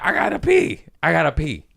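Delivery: high, loud, frantic protest; acted defensive yelp; desperate excuse. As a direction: plead your case at the top of your voice. Defends himself to horrified buddy.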